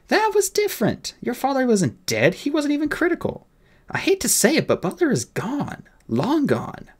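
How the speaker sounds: high-pitched, insistent fairy officer; fairy captain. Acted. Fairy telling the boy his bodyguard is gone, high and insistent. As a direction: argue it high, easing down at the end.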